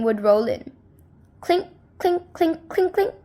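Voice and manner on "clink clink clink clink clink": higher, bright clink sounds; coin clinks. A narrator's reading. Narrator voices gold coins rolling in. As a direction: ring it out like coins.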